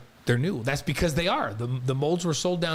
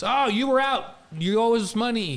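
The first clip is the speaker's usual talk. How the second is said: mock scolding; 8 semitones higher than usual. Mocking the conference's demand.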